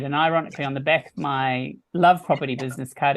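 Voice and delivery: normal talking voice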